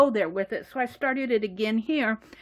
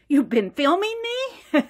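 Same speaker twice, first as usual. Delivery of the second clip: indignant question, pitched up; a little sassy; 7 semitones higher, 3 dB louder than usual. Acted. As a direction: act mildly affronted, voice rising high. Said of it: "sassy. She says".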